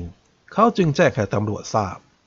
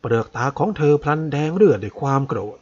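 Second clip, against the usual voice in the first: ordinary delivery, nothing marked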